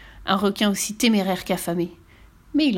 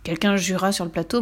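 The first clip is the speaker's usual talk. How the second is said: ordinary delivery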